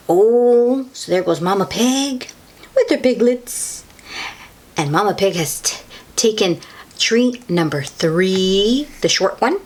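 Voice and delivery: ordinary delivery, nothing marked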